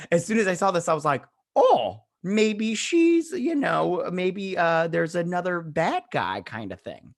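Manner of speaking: high, loud and slowed, startled guess; shocked realization. Retold or imitated. Recapping her reaction to the text, startled and high. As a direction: gasp out the theory as it dawns.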